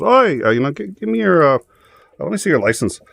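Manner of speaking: Irish cop. Retold or imitated. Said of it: "cop goes".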